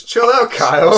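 high, loud "chill out"; mock scolding. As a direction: scold him, hammed-up and high.

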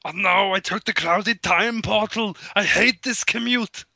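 high, hammed-up complaint; whining Arnold. Arnold in the clouded time portal, hammed-up griping.

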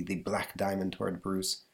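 plain reading voice

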